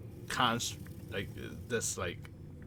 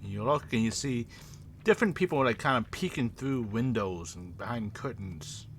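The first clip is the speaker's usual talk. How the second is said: quiet, low game-master narration; eerie scene setting; 5 semitones lower, 7 dB quieter than usual. Acted. Game master describing watching eyes and the dead, eerie narration. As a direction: set an ominous scene, softening toward the end.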